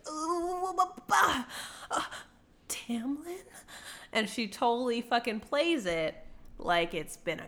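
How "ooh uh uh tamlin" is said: wobbly high noises sinking to a whisper; mock dazed coming-to, whispering the name; faked seizure recovery. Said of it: "shaking. And then she's like".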